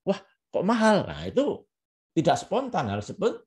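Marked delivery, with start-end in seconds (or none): 0.1-1.1 belated, higher-pitched show of surprise; unspontaneous, staged astonishment; delayed surprise. Shown as a demonstration. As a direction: react late, as if after a pause. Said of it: "terus Anda diam dulu, baru".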